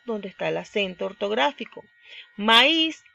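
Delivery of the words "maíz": "Maíz" said loud and high, weight on the final ÍZ; stress on the Í. Shown as a demonstration. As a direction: lean hard on the í in "Maíz". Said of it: "en la I".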